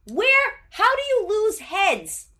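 high, stunned disbelief; incredulous. Real, not acted. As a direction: ask it like you can't believe it. Incredulous about lost heads.

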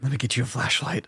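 gruff dude, softly spoken; Snake Plissken. Shown as a demonstration. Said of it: "like, Snake Plissken gruff dude".

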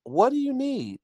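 high, sing-song mother voice, last line whispered; cooing mother. Retold or imitated. Mother soothing her fussing toddler at a march, high cooing voice. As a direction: coo to a fussing toddler like a doting mom. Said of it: "and she's like".

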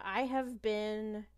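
usual speaking voice

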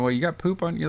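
high-pitched comic dismay; mock horrified. Bullock spots something on her boyfriend, in mock horror. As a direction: play up the disgusted discovery. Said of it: "Bullock's, like, horrified".

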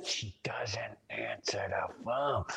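slow, low near-whisper; hushed exasperation. Retold or imitated. Husband telling of his unanswered calls, hushed exasperation. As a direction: drop to a slow, hushed aside. Exasperated aside about wife.

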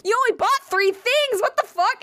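high-pitched mock disbelief; incredulous outburst. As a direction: retell it as a high, incredulous squawk. Incredulous reaction in retold exchange.

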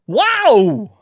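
high, loud Walken wow; Christopher Walken amazed. Retold or imitated. Comic showing the Walken amazed wow, high and loud. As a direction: gasp it like Walken at a magic trick. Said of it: "Christopher Walken sees an amazing magic trick".

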